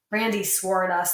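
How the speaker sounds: ordinary delivery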